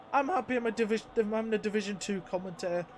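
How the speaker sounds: ordinary delivery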